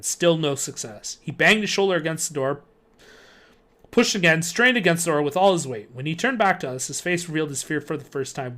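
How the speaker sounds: ordinary delivery, nothing marked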